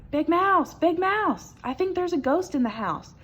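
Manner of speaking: high, loud scared little mouse cry; little mouse fearing a ghost; frightened little mouse. A narrator's reading. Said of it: "he cried".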